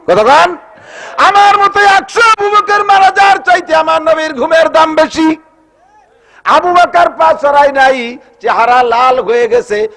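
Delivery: very high, loud sermon climax; full-force proclamation, coming down slightly at the end; impassioned preaching shout. A narrator's reading. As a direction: cry it out fervently to the crowd.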